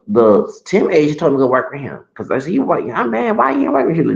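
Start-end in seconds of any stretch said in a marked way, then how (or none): 2.5-4.1 high-pitched imitation of a coworker's playful grumble; mock-annoyed teasing, voice pitched up; joking complaint. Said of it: "She joking, she just joking".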